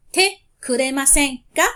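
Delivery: Te kuremasen ka said loud with a rising ka; teacher models Te kuremasen ka for students to repeat; model phrase for repeating. Shown as a demonstration. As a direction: announce it clearly for the class to copy, ka lifted. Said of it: "repeat after me".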